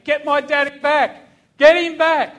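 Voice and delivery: high, very loud desperate scream; high, forceful re-enacted shout; retold scream. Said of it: "he screamed out at me".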